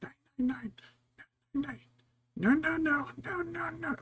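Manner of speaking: high, loud then thinning mock scolding; high-pitched mock "no, no, no"; sing-songy nagging imitation. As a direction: imitate someone shooing cats in a high sing-song. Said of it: "weird sing-songy voice that freaks the".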